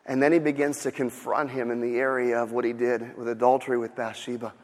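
ordinary delivery

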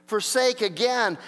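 normal talking voice

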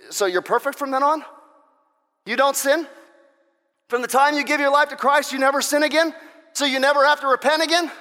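sarcastic questioning. Acted. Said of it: "some that think".

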